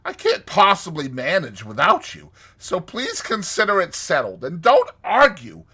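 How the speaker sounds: high, loud, insistent pleading; imploring. A narrator's reading. Toad urging Rat to leave the river, imploring. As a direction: press him hard, pitched well up. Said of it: "said Toad imploringly".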